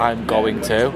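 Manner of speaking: "going to" pronounced as two full words. Shown as a demonstration. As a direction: say "going to" in full, never run together. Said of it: "had to say it as".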